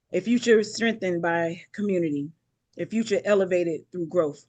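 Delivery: measured, declaiming speech to the council; stately address, pausing between each item in a list; formal keynote address. Acted. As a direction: proclaim each line like a keynote.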